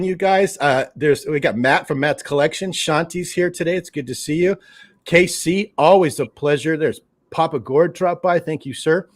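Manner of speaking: usual speaking voice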